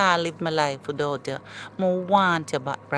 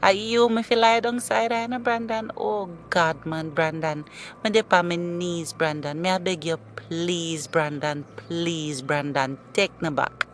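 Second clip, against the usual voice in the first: pleading. Acted. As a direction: plead on your knees to get him back. Ex begging to be taken back.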